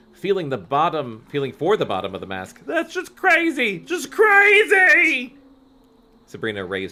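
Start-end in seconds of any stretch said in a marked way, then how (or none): 2.7-5.3 very high, loud drawn-out wail; panicked shriek. Acted. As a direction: drag out a frantic shriek. Carly Beth panicking.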